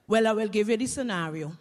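usual speaking voice

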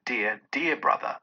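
put-on softly affectionate child; a hint of a small girl's voice; lightly voiced little girl. A narrator's reading. Little sister embracing her brother, lightly voiced affection. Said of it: "and a little girl".

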